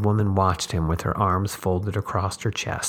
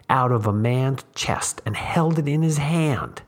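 intense tramp voice; 6 semitones higher, 4 dB louder than usual. A narrator's reading. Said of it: "leaning forward".

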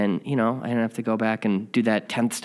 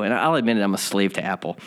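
usual speaking voice

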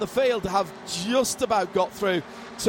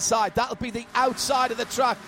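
raised, lively live race call; motorsport commentator; 4 semitones higher than usual. Acted. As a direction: call the overtakes as they happen.

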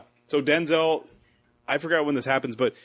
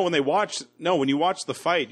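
usual speaking voice

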